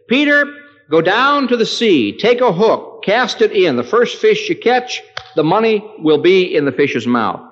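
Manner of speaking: high, loud commanding Jesus; preacher voicing Jesus' command. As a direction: call it out as the Lord instructing Peter. Jesus voiced giving command.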